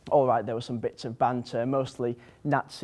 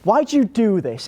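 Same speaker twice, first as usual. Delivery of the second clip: loud, high-pitched complaint; raised-voice indignant question; exasperated protest; 8 semitones higher, 7 dB louder than usual. Acted. Protest at the silly name.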